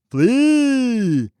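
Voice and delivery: high, loud booming command; one long booming cry; thundering voice. Acted. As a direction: thunder it inside his head. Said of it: "the voice thundered in his head".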